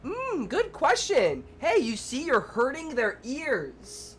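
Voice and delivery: high, slow, sugary teacher voice; exaggerated sweet reproach to a small child; pointed preschool teacher. Teacher telling a screaming kid off, high, slow and pointed. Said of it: "And then I'm like".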